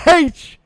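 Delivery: loud, high haitch with a breathy h; exaggerated haitch. Host teasing co-host by hamming up her H. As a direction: blow out the h of H hard. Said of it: "It's like cool whip".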